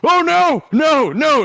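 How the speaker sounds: high, loud panicked no. Acted. Worker panicking as the kid drops his pants. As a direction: yelp in alarm.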